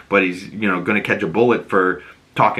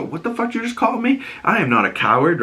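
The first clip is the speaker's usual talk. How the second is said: indignant, much higher and fast; outraged at being called a coward; offended thug; 9 semitones higher, faster than usual. Retold or imitated. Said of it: "the Sullivans go".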